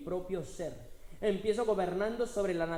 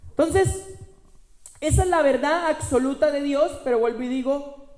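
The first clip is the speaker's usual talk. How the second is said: loud, high, building preacher's cry; preaching at full force; 7 semitones higher, 11 dB louder than usual. Acted. Pastor proclaiming to the congregation, loud and rising. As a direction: proclaim the power within, rising.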